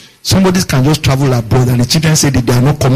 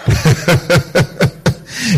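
rapid, rhythmic chuckle; real chuckle; 3 semitones higher, 3 dB quieter than usual. Real, not acted. Preacher amused mid-sermon, chuckling. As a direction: laugh briefly in a quick string. Preacher laughs between points.

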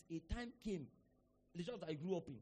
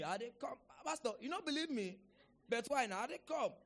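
higher-pitched mock pleading in Pidgin; lightly acted phone excuse; imitated protesting convert; 5 semitones higher than usual. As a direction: protest like you expect to be believed.

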